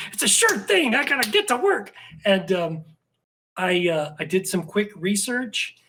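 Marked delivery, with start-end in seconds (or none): 0.1-1.9 much higher, hammed-up eagerness; eager self-quote. Writer jokes about taking up her offer, hammed-up eagerness. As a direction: play up being raring to go. Acting out his eager reaction.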